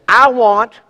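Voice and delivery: loud, high, defiant selfish voice; mock defiant child demanding its way; selfish attitude. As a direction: play a stubborn "me first" heart. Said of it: "this attitude says".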